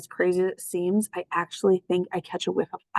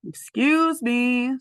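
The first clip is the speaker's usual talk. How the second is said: higher, dry polite interjection; sarcastic interruption; 6 semitones higher, 4 dB louder, much slower than usual. Acted. Gray Muzzle interrupting the reunion, dry sarcasm.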